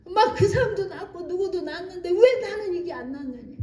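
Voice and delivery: normal talking voice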